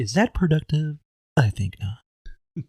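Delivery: mock corporate-video admonishment; training video narrator. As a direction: pose it, then answer very deliberately. Co-host joins training video parody.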